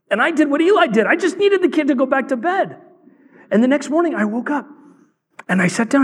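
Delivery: high, urgent preacher's voice; impassioned preaching. Real, not acted. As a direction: confess it with rising, high-pitched fervour.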